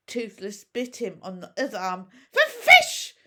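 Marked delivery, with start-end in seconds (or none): 2.3-3.1 very high, loud, stammering little dragon hiss; hissed dragon demand. A narrator's reading. Little dragon hissing at Hiccup for fish. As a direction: hiss it out like a tiny greedy dragon.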